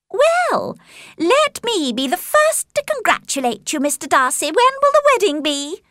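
very high, affected congratulation; mocking Caroline. A narrator's reading. Caroline congratulating Darcy on a wedding, mockingly. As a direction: gush in a high, teasing voice. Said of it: "continued to mock the Bennet family".

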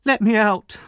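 loud, high yell; defiant high shout. Acted. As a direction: demand release through a locked door. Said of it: "he shouted from the cellar".

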